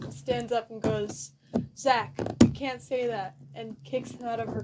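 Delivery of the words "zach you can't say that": scolding teacher. Acted. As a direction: snap at him like a stern teacher.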